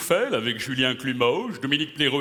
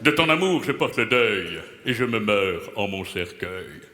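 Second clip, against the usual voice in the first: grand, lower poem recital; mock declamation; 7 semitones lower than usual. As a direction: declaim his own verse like a tragedian. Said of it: "que je vais déclamer devant vous".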